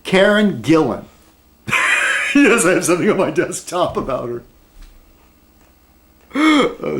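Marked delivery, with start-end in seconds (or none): 1.7-4.4 giggling through the words; laughing. Real, not acted. Vlogger giggling that he keeps her photo, laughing. 6.3-7.0 sharp gasp, then oh. Real, not acted. Vlogger finding her photo on his desktop, gasping. Sees the actress's picture.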